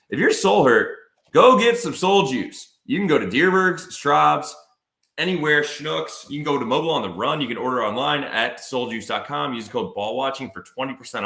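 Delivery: high, punchy sponsor pitch; ad read. Real, not acted. Host pitching soul juice to listeners, punchy ad read. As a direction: sell it loud and high, then ease off.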